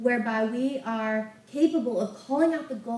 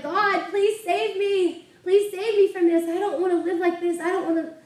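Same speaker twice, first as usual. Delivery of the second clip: high, pleading cry, much louder; a preacher reenacting a desperate plea; crying out to God; 8 semitones higher, 6 dB louder than usual. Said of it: "she cried out".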